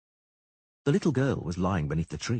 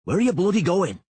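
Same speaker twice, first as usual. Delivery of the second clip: much higher and louder angry shout; 10 semitones higher, 8 dB louder than usual. A narrator's reading. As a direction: yell after her, furious. Shouting after fleeing girl.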